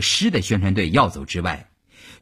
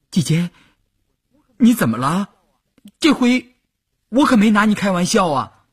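anxious; 11 semitones higher, 4 dB louder than usual. A narrator's reading. Startled boy asks crying girl.

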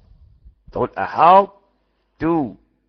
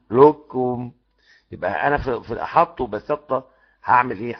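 normal talking voice

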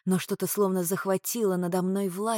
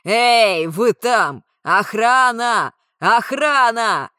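yelling much louder, slow final calls; shouting for guards; 3 semitones higher, 12 dB louder, much slower than usual. Acted. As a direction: call out for the guards at full voice. Calling the guards for help.

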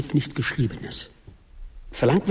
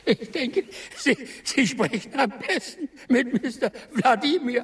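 nervous laugh; 10 semitones higher than usual. Acted. Official laughs, passes him along.

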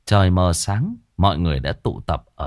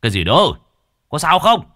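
quick, loud, urgent demand; husband yelling in a panic; alarmed shout; 7 semitones higher, 6 dB louder than usual. Acted. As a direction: shout it out in alarm, pitch jumping up. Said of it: "Lão Trương hớt hải chạy xuống quát".

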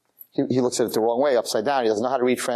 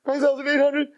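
high-pitched excited counting; mounting excitement; 12 semitones higher than usual. Acted. Man counting his windfall, thrilled. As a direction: count it up in rising disbelief.

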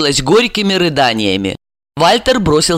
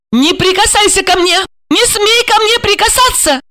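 very high-pitched frantic protest; hysterical cry. A narrator's reading. Wife recoiling from husband's touch, hysterical cry. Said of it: "истерично закричала она".

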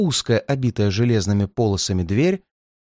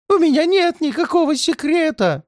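much higher, whining denial; plaintive old man. A narrator's reading. Levi Kanzer denies his secret, high and plaintive. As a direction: plead innocence in a high whine. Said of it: "жалобно заговорил Леви Канцер".

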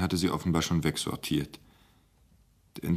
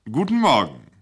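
louder, booming good morning; loud greeting; 5 semitones higher, 6 dB louder than usual. Acted. The star speaker enters the director's office, loud greeting. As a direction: boom the greeting out. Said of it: "setzte sich müde".

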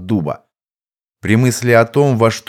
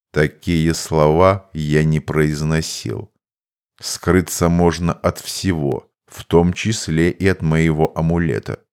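composed mage; 5 semitones lower than usual. Acted. Mage explains the limits of his amulet, measured and low.

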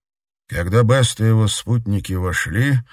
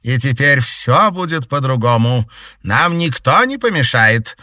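gloating villain; 6 semitones higher, 7 dB louder than usual. A narrator's reading. Baroness gloats.

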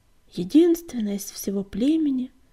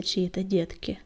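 ordinary delivery

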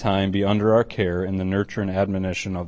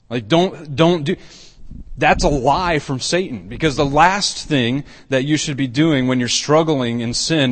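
loud, raised preaching voice; full-force preaching; 5 semitones higher, 5 dB louder than usual. Acted. Pastor urging struggling believers, loud fervent preaching. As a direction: drive it home to the congregation.

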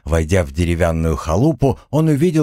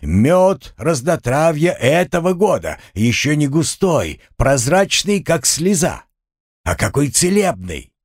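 praising the goods; 7 semitones higher than usual. A narrator's reading. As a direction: sell it like the best honey. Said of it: "расхваливая качество".

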